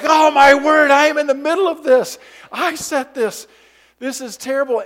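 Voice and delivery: high, loud panicked rush. Retold or imitated. Boy panicking in the middle of the fight. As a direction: freak out at the mess you made. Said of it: "And I'm like".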